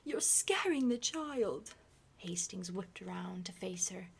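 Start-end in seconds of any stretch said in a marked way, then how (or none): 0.0-1.7 alarmed plea. Acted.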